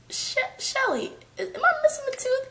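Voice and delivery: stammered, high-pitched plea; mock panicky question. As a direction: re-enact dawning panic, very high. Re-enacts asking friend about missing tooth.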